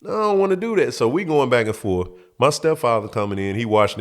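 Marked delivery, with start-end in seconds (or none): 0.0-0.9 high refusal. Retold or imitated. Kid refusing.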